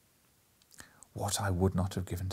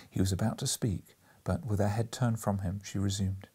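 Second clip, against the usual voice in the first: ordinary delivery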